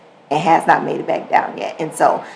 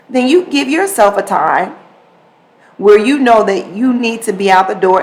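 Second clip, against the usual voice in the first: usual speaking voice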